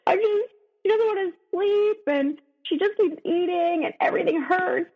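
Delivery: high, tearful retelling of a sobbing plea; crying plea. Retold or imitated. New mother crying to her husband at three a.m., tearful and high. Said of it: "I start crying and I was like".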